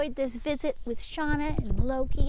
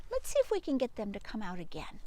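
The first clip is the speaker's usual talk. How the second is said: usual speaking voice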